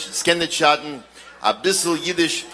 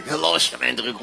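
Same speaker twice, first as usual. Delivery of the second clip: lightly voiced puppet; a hint of a cheeky puppet; 3 semitones lower, 3 dB quieter than usual. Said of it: "Say hello, Schmendrick".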